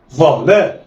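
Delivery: "ظا لا" joined in one quick go; snatch "ظا لا" with no pause; snatched together. Shown as a demonstration. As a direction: blend "ظا لا" like one word. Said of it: "دي طريقة الخطف".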